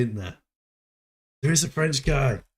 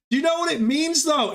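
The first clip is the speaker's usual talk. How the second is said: teasing high quiz; 12 semitones higher than usual. Real, not acted.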